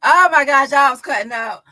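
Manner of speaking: loud, delighted disbelief; amused outburst. Real, not acted. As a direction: exclaim loudly at the outrageous mischief. Bursts out after coach's jab.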